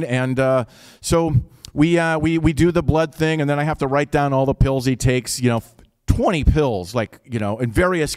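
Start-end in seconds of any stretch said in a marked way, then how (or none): none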